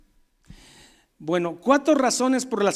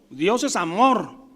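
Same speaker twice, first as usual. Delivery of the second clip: ordinary talk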